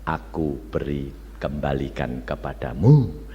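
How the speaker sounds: deep, quiet divine reply; voice of God. Retold or imitated. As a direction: speak as God, hushed and measured. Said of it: "Tuhan berkata apa?".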